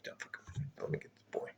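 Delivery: hushed whisper off to the side; quick whispered aside to himself. Real, not acted. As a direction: drop to a whisper as you step away. Aside while fetching the boy.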